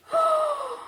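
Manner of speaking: sudden high frightened gasp. Acted. Witch startled by the dragon, sharp gasp.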